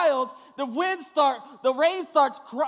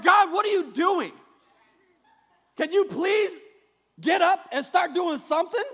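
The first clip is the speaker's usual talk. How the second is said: higher, loud demanding prayer; complaining prayer; 3 semitones higher, 4 dB louder than usual. Retold or imitated. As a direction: nag God to get up and act. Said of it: "that's what our prayers sound like".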